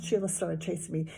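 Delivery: ordinary delivery, nothing marked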